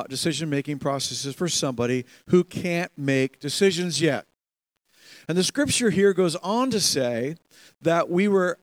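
ordinary talk

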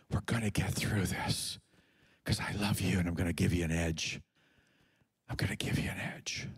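low, soft, tender reassurance, ending in a whisper; God's gentle voice. Acted. God reassuring a scared believer in a soft low voice. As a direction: lean in and comfort them quietly.

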